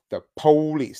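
"police" as a drawn POH-lees; slang pronunciation of police. Shown as a demonstration. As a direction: hit the first syllable of "police": POH-lees. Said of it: "That's how they say it".

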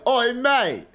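high-pitched mock British accent; badly faked British lad, hammed up; Don Cheadle. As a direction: parody a bad British accent. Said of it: "Don Cheadle's like".

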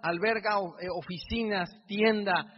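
loud, rousing stage address to the crowd; preaching at full force. Acted. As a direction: preach it big, nearly shouting.

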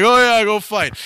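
brief mock-enthusiastic imitation; mocking sarcasm. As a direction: mimic a fake-keen answer. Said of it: "you're being sarcastic".

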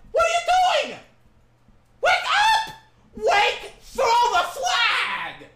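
screaming at the ref, voice cracking high and loud; furious shout. Real, not acted. Fan screaming at the referee on TV, furious shout. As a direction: yell it like the ref just robbed you. Yelling at referee over missed hold.

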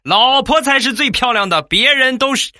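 high, loud rushed blurt of praise; reflex blurt. Retold or imitated. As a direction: blurt it out by reflex, fast and eager. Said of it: "本能反应".

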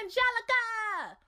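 high, loud call of her name; excited name call. Real, not acted. Calling out the host's name.

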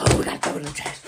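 hushed, scared aside; panicked whisper. Retold or imitated. Mocking the watchers as they panic in a whisper. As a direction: whisper it like you're hiding evidence. People voiced panicking over evidence.

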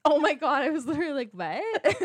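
loud laughing exclamation; laughing shout. Real, not acted. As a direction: burst out laughing, loud and high. Bursts out laughing at the mix-up.

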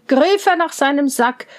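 plain reading voice

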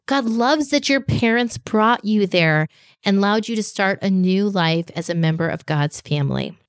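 normal talking voice

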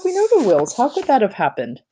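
high, upset disbelief; can't believe it of them; shocked disbelief. A narrator's reading. Mother protesting to the dean in shocked disbelief. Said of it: "cried Mom in shock".

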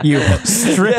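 teasing through laughter; laughing tease. Real, not acted. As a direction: jeer with a laugh.

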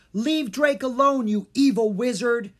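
put-on child yelling to protect his friend; crying out. A narrator's reading. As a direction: shout at the evil wizard. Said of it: "Jean cried".

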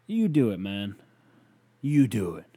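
loud, punched challenge; mad challenge. Real, not acted. Podcaster daring the critic to do it, mad. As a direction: throw it back at them, heated. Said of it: "I don't know why I'm so mad".

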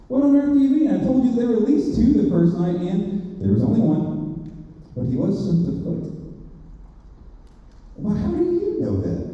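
put-on indignant young boy; boy's voice. A narrator's reading. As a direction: push back like an astonished child. Boy Shasta arguing.

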